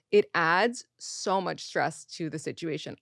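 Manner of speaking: usual speaking voice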